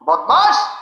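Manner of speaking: high, sharp mock scolding; hammed-up angry cry of a wife at the door. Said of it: "স্ত্রী রাগে বললেন".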